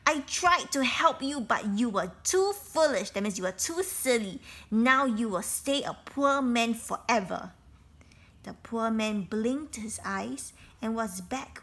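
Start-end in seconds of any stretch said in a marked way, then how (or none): 0.1-3.1 loud, scolding bird voice; angry scolding. Acted. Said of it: "she became angry". 4.7-7.5 angry verdict. Acted. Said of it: "she became angry".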